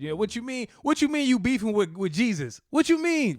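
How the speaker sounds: mock outraged questioning, punching it up; indignant challenge. People retelling indignant challenge.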